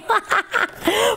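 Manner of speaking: high-pitched burst of laughter. Real, not acted. Crafter laughs at getting emotional over the tail, high laugh. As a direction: laugh at your own overreaction. Laughs at her own overreaction.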